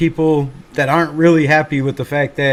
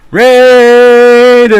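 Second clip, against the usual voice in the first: hype shout; 8 semitones higher, 13 dB louder than usual. Real, not acted. Streamer shouting the team name to chat. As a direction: belt "Raiders" out high and loud.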